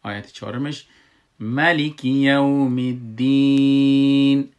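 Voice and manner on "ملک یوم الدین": slow, loud chanted ملک یوم الدین; ملک said as malik in a long held chant; Quran recitation. Shown as a demonstration. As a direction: recite slowly, with a kasra on the lam of ملک. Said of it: "ملکی گفتم، لام کسره دادم".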